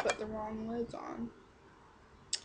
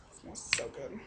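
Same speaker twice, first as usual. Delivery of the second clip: whispered; 8 semitones lower, 6 dB quieter than usual. Real, not acted. Sniffing the fresh dip can.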